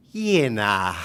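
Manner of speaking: a broad Kiwi Yeah, nah; demo Kiwi phrase. Shown as a demonstration. Kiwi speaker quizzing the audience, broad Kiwi demo. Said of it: "first let's say it".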